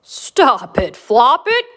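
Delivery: loud storybook grumble; emphatic, lightly acted grumble; grumbling cow. A narrator's reading. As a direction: scold the bunny as a put-upon cow. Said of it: "grumbled Cow".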